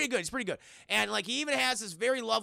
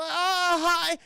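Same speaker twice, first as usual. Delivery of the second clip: high, awkward, louder hello; uncomfortable; 8 semitones higher, 6 dB louder than usual. Retold or imitated. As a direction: greet them awkwardly, pitched up. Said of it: "He's very uncomfortable".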